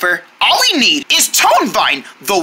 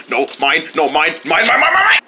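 possessive; 5 semitones lower than usual. Acted. Fighting over the last one.

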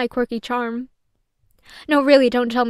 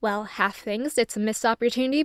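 ordinary delivery

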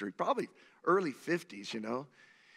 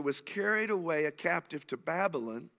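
ordinary talk, nothing marked

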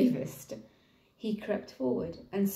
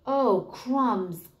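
gulping; 3 semitones higher, 4 dB louder than usual. Acted. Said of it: "gulped the elephant".

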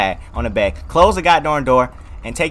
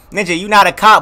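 ordinary talk

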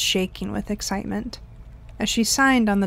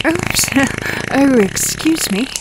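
put-on high, louder childish oops with giggles; juvenile giggly; 5 semitones higher, 5 dB louder, slower than usual. A narrator's reading. Tabby after farting in the boardroom, childish giggling. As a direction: giggle through it like a naughty kid. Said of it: "Tabby said in a juvenile way".

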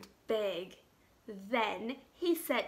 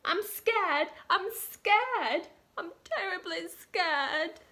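high, trembling frightened cook voice; scared cook; 8 semitones higher than usual. Acted. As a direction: squeak it out like a terrified little cook. Scared cook repeats his fear.

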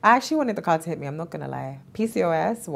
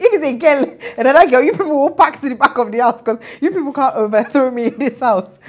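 loud, higher mock-bossy husband laughing through it; teasing husband; 7 semitones higher, 8 dB louder than usual. Husband teasing about having girls, loud mock-bossy voice. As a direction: boss the household around in jest. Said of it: "he was like".

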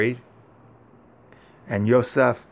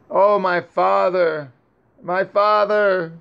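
higher, slow, grief-stricken calling out; weeping cry; 7 semitones higher than usual. Acted. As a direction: cry out slowly for his lost father. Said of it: "Yosef continued to cry and weep".

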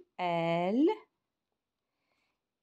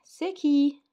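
acted question; 8 semitones higher, 9 dB louder than usual. Teacher voicing dialogue question.

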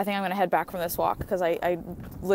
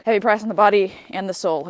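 heavy price, pushed louder; louder emphatic; 7 dB louder than usual. Shown as a demonstration. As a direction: repeat it with dramatic weight. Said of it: "repeated with emphasis".

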